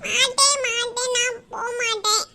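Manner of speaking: put-on whining, drawn-out child's refusal; childish wailing protest; stubborn child. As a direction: dig in like a tearful little girl. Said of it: "ஜில்லு இவ்வளவு அடம் பிடிக்கிறா".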